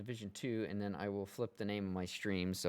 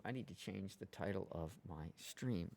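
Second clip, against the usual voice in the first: ordinary talk, nothing marked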